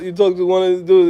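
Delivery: usual speaking voice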